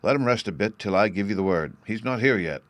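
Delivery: plain reading voice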